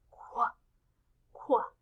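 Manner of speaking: "qua" with k and w blended; isolated, short kw-uh for each "qua"; Q sound. Shown as a demonstration. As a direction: sound out "qua" with a pause between. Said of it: "stick together a C sound and a W sound".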